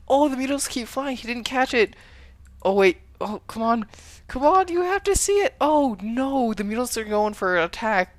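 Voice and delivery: high, loud, urgent play-by-play; excited casting. Real, not acted. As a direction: call it like a tense moment. Caster hyped over Mutalisk chase.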